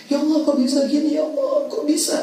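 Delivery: high, pleading cry to God, half acted; mock sad lament. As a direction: act out a complaining sigh of despair, voice pitched high. (ratapan sedih yang dibuat-buat, nada tinggi)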